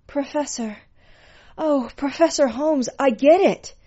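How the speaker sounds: high, impassioned female character voice; ecstatic pet. A narrator's reading. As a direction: cry out to the professor in rapture. Pet's cries during sex scene.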